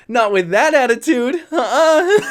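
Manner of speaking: high, loud refusal breaking into laughter; amused refusal. Real, not acted. Storyteller rejecting girlfriend's attitude, high laughing refusal. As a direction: pitch it way up, about to crack up.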